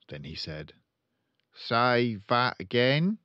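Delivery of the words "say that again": blustering, slow, high car-dealer dad; pompous father voice; loud pompous father. Acted. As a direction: boom it out like a showy salesman.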